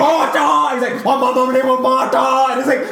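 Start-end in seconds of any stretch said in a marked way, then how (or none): 0.0-0.4 high, loud comic shout; mock cry. Friend mocking the Martha scene, hammed-up shout. 1.0-2.5 higher, garbled dopey cry; mock hero wail. Friend mocking the Martha scene, dopey wail.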